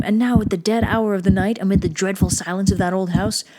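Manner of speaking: plain reading voice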